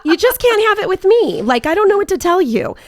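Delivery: high, loud laughing protest. Real, not acted. Laughing to friends about a creepy client, high and loud.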